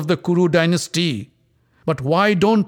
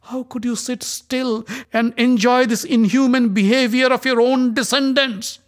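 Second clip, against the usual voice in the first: much higher, louder accusing cries; furious rebuke; 10 semitones higher, 4 dB louder than usual. Acted. Draupadi rebuking the silent Kuru elders, high and furious.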